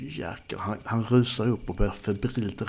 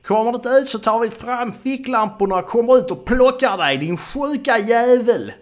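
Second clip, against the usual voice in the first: loud, high, overshrill threat; shrill screaming; 12 semitones higher, 9 dB louder than usual. Acted. Trembling man waving a knife at the forest, shrill shout. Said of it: "aningen förgällt".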